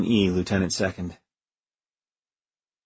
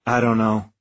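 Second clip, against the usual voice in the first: ordinary talk, nothing marked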